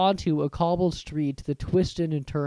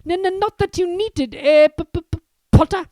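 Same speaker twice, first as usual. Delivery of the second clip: high, nervous stutter; stammering Quirrell; 13 semitones higher, 7 dB louder than usual. Acted. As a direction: stutter anxiously.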